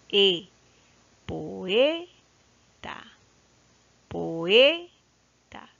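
poeta slowly, E held long and rising before TA; stress on E. Shown as a demonstration. Teacher answering where the stress is, E stressed in poeta. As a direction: swell on the E of poeta, then drop TA. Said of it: "Dónde está la mayor fuerza de voz".

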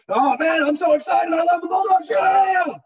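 high, loud, excited shout about the team; excited fan. Retold or imitated. Larry gushing about his Bulldogs, high and loud cheering. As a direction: cheer it out like a diehard football fan.

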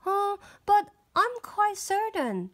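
high, certain princess. Acted. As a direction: insist with wide-eyed certainty. Princess insists.

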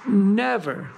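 "never" drawn out slowly with firm weight; emphatic stress on NEVER. Real, not acted. Warning climbers off cotton clothing, heavy stress on "never". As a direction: lean hard on "never" and stretch it. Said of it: "I'm emphasizing the word never".

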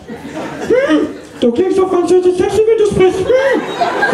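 very high, breathy swooning voice; swooning women. Retold or imitated. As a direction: coo it like an infatuated fan. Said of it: "sagen sie mit klippernden Augen".

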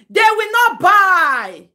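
slow, loud shout stressing not; loud insistence. Real, not acted. Shouting the warning at Mary, slow and loud. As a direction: drag out and shout "They will not buy".

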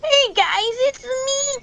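put-on high-pitched, annoying little kid; annoying childish voice. Player shows off Balloon Boy on request, annoying childish voice. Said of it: "do your most annoying childish voice".

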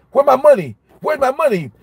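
high, pushy demand for money. Retold or imitated. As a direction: mimic a pushy creditor, high. Retold debtor demanding money.

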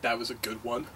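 soft, low, menacing question; ominously hushed and questioning; quiet offence. Acted. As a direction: echo it back quietly, taking offence. Star takes offence, ominously quiet.

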